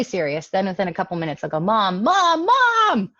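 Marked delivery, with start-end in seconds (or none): 1.6-3.1 "Mom, Mom, Mom" building from louder to a high yell; each "Mom" bigger until it's a mock yell; escalating calls. Kid demanding mom's attention, calls building to a yell. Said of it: "They escalate, they get bigger, they get louder".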